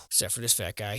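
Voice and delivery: ordinary delivery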